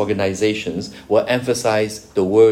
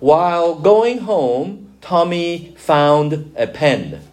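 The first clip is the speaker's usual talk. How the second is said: slow, deliberate example read out; slow example sentence; 5 semitones higher, slower than usual. Shown as a demonstration. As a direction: lay out the sentence slowly so they can catch it. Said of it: "So, listen to this sentence".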